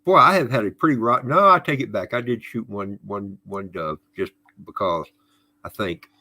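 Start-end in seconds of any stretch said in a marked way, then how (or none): none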